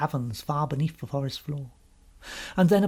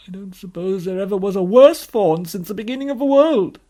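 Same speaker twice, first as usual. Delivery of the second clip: loud, raised, woeful voice; wailing faun; 5 semitones higher, 10 dB louder than usual. Acted. Faun declaring himself the worst fawn, wailing. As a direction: build into a loud lament. Faun wails his guilt.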